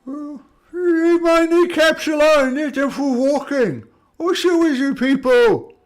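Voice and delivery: high, loud, hammed-up complaint; aggrieved giant. Giant scolding those attacking his kneecaps, loud and indignant. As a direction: wail it like a picked-on monster.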